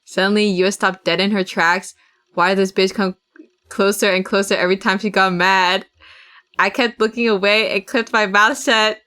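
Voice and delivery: normal talking voice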